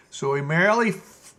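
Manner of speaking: usual speaking voice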